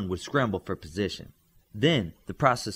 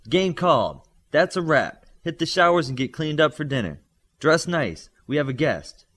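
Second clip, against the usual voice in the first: loud coach call; 6 semitones higher, 6 dB louder than usual. A narrator's reading. Coach ending practice across pit.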